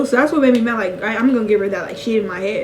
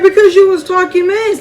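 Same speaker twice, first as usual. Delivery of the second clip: higher and louder, indignant mock retort; heated; 8 semitones higher, 5 dB louder than usual. To her brother about the fight, indignant retort. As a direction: snap back at the big brother.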